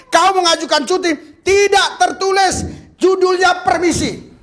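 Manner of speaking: high, loud, slowing preacher; fiery sermon climax; full-force preaching. Acted. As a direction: drive it home to the congregation. Preaching peak.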